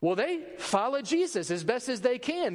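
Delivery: usual speaking voice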